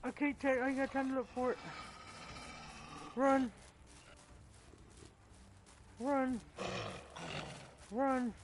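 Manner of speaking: high, loud, frantic yelling while fleeing; panicked yelling. Real, not acted. Gamer fleeing a wolf, panicked high loud yelling. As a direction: yell in real panic as you run.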